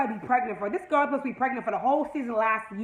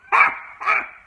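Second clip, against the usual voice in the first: breathy laugh; 9 semitones higher, 6 dB louder than usual. Real, not acted. Laughing at the cheesy romance.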